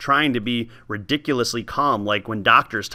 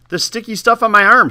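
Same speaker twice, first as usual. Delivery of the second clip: higher, louder impatient reply; 9 semitones higher, 5 dB louder than usual. Acted. Old woman telling the boy what to peel, impatient. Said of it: "she said impatiently".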